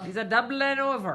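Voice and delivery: hammed-up Irish lilt; Irish accent. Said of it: "I can do an Irish accent".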